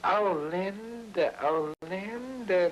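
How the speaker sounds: high, slow mock pleading; drawn-out mock whine; mimicking a pleading guy.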